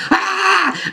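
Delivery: short high mock scream; mock shriek. Widow seeing her husband explode, mock shriek. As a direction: squeal it high like she's horrified. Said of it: "She's like".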